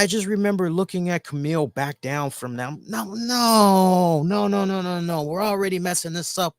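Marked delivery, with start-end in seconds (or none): 2.9-6.6 raised-pitch string of protesting no's; alarmed objection. Retold or imitated. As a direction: replay a dismayed inner protest. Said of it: "was my thought process".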